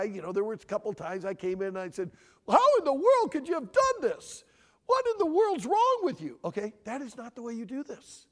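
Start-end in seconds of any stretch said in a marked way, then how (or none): 2.5-6.4 high, loud retold yell; emotional parent outburst. Dad recalling storming in on his kids, angry yelling. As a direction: imitate a dad blowing up at the kids. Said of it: "more of an emotional guy".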